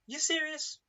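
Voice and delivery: high disbelieving jab; you can't be serious; incredulous. Acted. Incredulous crowd voice.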